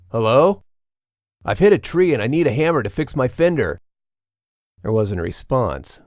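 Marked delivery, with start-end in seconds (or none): 0.1-0.6 loud call into the dark woods. A narrator's reading. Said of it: "he called out to them". 1.5-3.8 raised, higher voice calling across. A narrator's reading. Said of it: "he called out to them".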